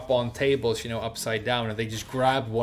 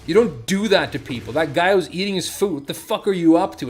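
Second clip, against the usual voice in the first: retold outrage, higher and louder; angry scolding; 5 semitones higher, 6 dB louder than usual. Huge rescuer scolding the man on the wall, angry. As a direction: dress him down, voice raised. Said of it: "And he's like".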